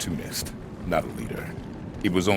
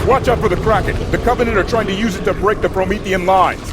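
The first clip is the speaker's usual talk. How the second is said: higher and louder orders over gunfire; raised voice in combat; battle shout; 13 semitones higher, 8 dB louder than usual. Acted. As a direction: shout commands across the battlefield. Giving orders mid-battle.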